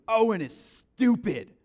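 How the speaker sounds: loud, slow mock kid voice; fed-up student. Another student walking into class, loud and slow. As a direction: grumble it loudly like a ninth grader.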